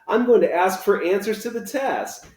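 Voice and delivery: put-on excited kid. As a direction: rattle off the wishes with glee. Oliver's excited wish list.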